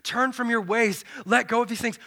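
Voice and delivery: ordinary talk, nothing marked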